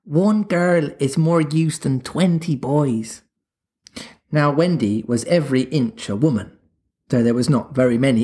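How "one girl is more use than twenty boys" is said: slow, tender, coaxing; charming voice. A narrator's reading. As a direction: win her over slowly. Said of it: "in a voice that no woman has ever yet been able to resist".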